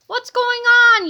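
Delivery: loud, high demanding shout; yell. A narrator's reading. Kipper shouts at the screaming cheerleaders, loud and high. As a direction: bark it across the hallway. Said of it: "yelled Kipper".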